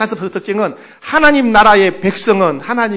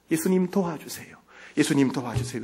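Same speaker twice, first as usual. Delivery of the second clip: soft, humble prayer; quiet plea; 2 semitones lower, 8 dB quieter than usual. Shown as a demonstration. Believer in life's storm asks Jesus, quietly pleading. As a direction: ask for help under your voice.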